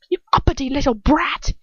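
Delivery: high, loud snarl; a vicious cry of contempt; hissed insult. A narrator's reading. Dolohov hissing at the defiant girl, high and loud. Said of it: "he hisses at me".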